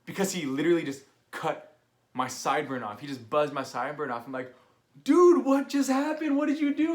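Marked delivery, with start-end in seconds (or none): 5.0-6.9 shocked outcry. Retold or imitated. [name] confronts his buddy over the missing sideburn, loud shocked yell. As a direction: yell it in stunned disbelief.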